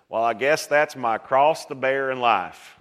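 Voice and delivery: slow, put-on Southern drawl; badly imitated Southern drawl, drawn out; poor Southern accent. Preacher imitating people resigned to their cross, in Southern accent. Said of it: "Excuse my poor Southern accent".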